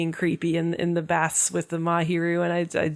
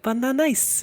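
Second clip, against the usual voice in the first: higher, cutesy pleased voice; cute happy voice; 6 semitones higher than usual. Retold or imitated. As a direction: sound like a happy little girl. Banana pleased.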